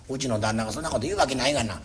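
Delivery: wife snapping back low and fast. Acted. Wife dismissing the fishmonger, low and quick.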